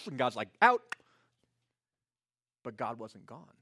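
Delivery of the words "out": loud, high one-word command; sudden shout. Acted. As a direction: bark it out as God sending him off. God dismisses Gideon abruptly.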